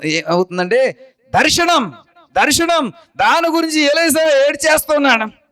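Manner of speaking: high, ringing preacher's voice; full-force preaching. Acted. Preacher proclaiming Elisha's prophecy to the church, fervent.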